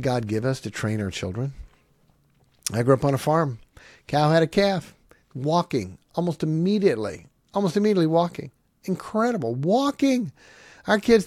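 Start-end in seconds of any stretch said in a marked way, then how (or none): none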